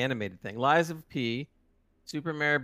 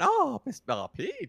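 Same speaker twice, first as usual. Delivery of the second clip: high swooning cartoon voice falling away; Mario; 8 semitones higher, slower than usual. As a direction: act a hammy cartoon hero calling his princess. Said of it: "it'll be like Mario going".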